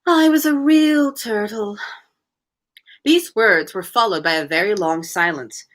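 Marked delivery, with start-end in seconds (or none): 0.1-1.8 deep sigh. Acted. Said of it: "with a deep sigh".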